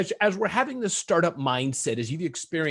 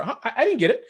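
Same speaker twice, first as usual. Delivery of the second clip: lightly voiced confused customer, pitched higher; mock bewilderment, high-pitched; puzzled customer; 7 semitones higher, faster than usual. Host imitating a customer who missed the emails, mock puzzled.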